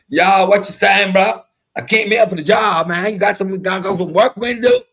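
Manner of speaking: loud, high street voice at the interview; street slang job seeker. Retold or imitated. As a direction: ham up the slangy applicant. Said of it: "When you go to an interview".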